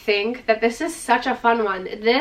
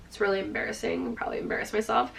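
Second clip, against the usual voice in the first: embarrassed mumble; 9 dB quieter, faster than usual. Real, not acted. Aside about mispronouncing shade name.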